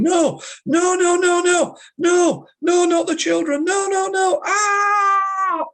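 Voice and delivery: shrieking no in very high panic, ending in a cry; high, desperate retold scream of no; terrified screaming. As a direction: scream it like soldiers are taking your children. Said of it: "he was screaming".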